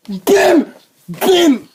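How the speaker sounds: loud, high "bim" like a landed punch; punch noises. Pretend. Boxing with a friend, loud mimed punches. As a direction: punch each syllable out hard.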